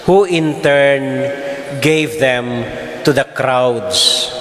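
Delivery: ordinary delivery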